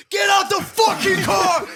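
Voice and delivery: high, drawn-out mock scream; imitated angry shout. Imitating friend ordering a driver out, high drawn-out shout. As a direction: scream the order, stretching the words.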